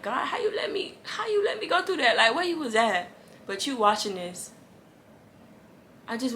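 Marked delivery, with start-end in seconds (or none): 0.0-3.1 high, half-laughing plea to God; doubting believer. Retold or imitated. Viewer questioning God, in a high half-laughing plea. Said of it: "And you like".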